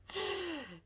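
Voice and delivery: short high-pitched laugh; embarrassed little laugh after a fumble. Real, not acted. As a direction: burst out laughing at your own slip. Laughs at own fumbled line.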